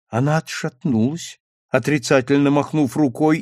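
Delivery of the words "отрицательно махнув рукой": ordinary delivery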